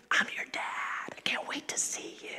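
put-on hushed whisper, as if to an unborn baby; tender unvoiced whisper from a father-to-be; whisper to the belly. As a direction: breathe the words softly to the bump. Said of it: "You start talking to them in the belly".

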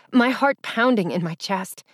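ordinary delivery, nothing marked

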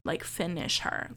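mock mother's whispered urging; urgent whisper. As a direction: hiss the order under the breath. Mother urging son to kill.

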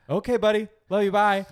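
chirpy mom signing off. Retold or imitated. Mom voiced goodbye.

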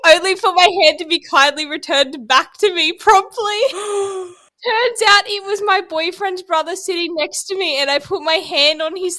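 high-pitched, giggly read-aloud; struggling through laughter. Real, not acted. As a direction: try to keep reading while cracking up. Reading embarrassing story, cracking up.

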